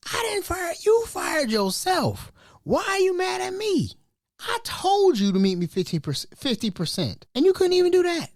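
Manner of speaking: high-pitched, wounded comeback; indignant protest. Acted. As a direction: plead your innocence in mock outrage. Indignant imagined reply to fired worker.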